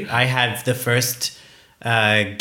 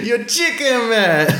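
teasing; 11 semitones higher than usual. Real, not acted. Teasing friend for chickening out.

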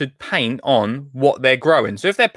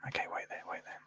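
barely audible whisper; hushed, voiceless stalling; whispered aside; 21 dB quieter, much faster than usual. Real, not acted. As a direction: whisper to yourself while busy searching. Muttering while looking up a tutorial.

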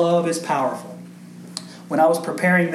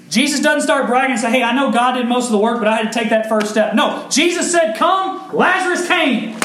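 preaching at full force; 6 semitones higher, 3 dB louder than usual. Acted. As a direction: pitch lifted high, a little louder, driving the promise home. Fervent preaching run.

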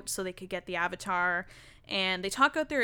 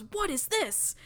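high, questioning imitation of an accuser; mock confrontation; 8 semitones higher than usual. Katara confronting Toph over a found poster, indignant. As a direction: voice someone demanding an explanation, pitched up.

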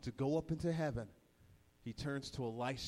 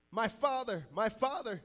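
read-out cry; 7 semitones higher than usual. Retold or imitated. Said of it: "he cried".

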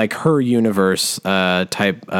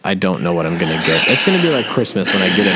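normal talking voice

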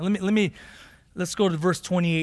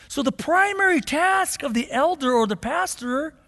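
high, loud pulpit proclamation; fervent preaching; 11 semitones higher, 5 dB louder than usual. Real, not acted. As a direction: drive each command home to the congregation. Preaching at full force.

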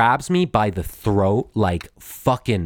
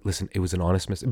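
soft, fast, placating plea; very quietly; 4 semitones lower, 6 dB quieter, much faster than usual. Retold or imitated. Inmate calming a violent deputy, soft fast plea. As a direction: talk him down softly. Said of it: "I said to him very quietly".

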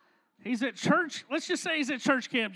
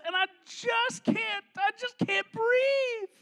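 high, tearful whine; hammed-up weepy girlfriend missing him; needy girlfriend; 6 semitones higher than usual. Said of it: "little Toaster Strudel, oh, she's so needy".

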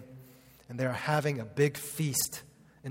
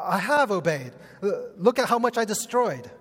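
higher, louder smug boast; mocking Saul; 5 semitones higher, 4 dB louder than usual. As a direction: parody his self-satisfied excuse. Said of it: "This is what Saul does".

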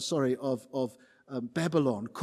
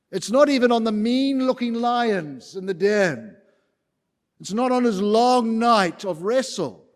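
louder, driving sermon cadence; preaching at full force; 5 semitones higher, 6 dB louder than usual. Acted. Preacher rousing the congregation about God's power. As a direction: hammer each God of line.

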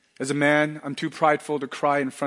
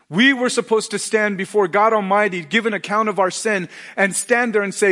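higher and louder, driving the sermon; a preacher at full volume pressing the point home; full-force preaching; 8 semitones higher, 8 dB louder than usual. Acted. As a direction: preach it out with rising fervour.

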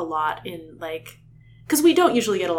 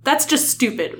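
higher, louder acted retort; angry; 7 semitones higher, 5 dB louder than usual. Rachel dismissing Tobias's excuse in a reading, angry. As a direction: cut him off angrily. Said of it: "Rachel said angrily".

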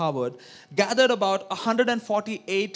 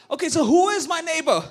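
higher and louder, smug challenge; smart-aleck question; 7 semitones higher, 5 dB louder than usual. Retold or imitated. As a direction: ask it like you're trying to outsmart. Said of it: "he tries to outplay and ask Jesus".

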